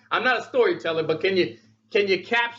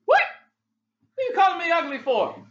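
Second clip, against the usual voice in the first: higher, fast, put-out reply; offended Rich reply; 9 semitones higher, faster than usual. Retold or imitated. Rich reading the text, offended and high. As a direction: act hurt and demand why. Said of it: "if I were to text that to Rich".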